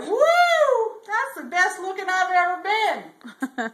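whoop, then draw out the proud admiration; delighted whoop. Acted. Bubba delighted with new outfit.

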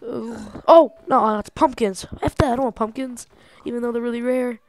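ordinary talk

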